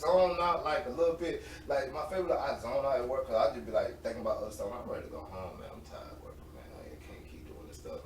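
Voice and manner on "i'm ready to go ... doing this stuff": very soft, low mumbling to oneself; weary inner monologue. Retold or imitated. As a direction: think aloud, tired and far away. Acting out weary zoned-out thoughts.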